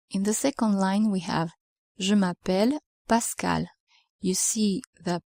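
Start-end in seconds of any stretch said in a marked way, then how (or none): none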